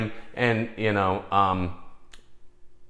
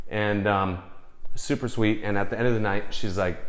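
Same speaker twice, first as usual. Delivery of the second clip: normal talking voice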